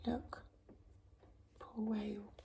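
soft whisper of sympathy; pitying whisper. Real, not acted. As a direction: whisper it, sorry for the whale. Aside to child over book.

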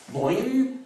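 ordinary talk, nothing marked

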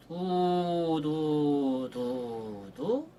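두 said long and smooth; easy connected 두 syllables; soft legato du. Shown as a demonstration. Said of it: "쉽게 그냥". (부드럽게 길게 두)

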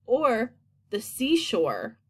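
usual speaking voice

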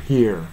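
"here" ending in an American r; resonant R. Shown as a demonstration. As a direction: sound the r clearly at the end of "here". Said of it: "Rの発音が響きます".

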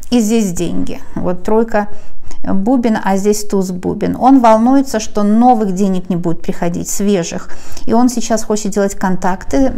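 ordinary talk

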